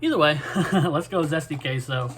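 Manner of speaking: ordinary talk, nothing marked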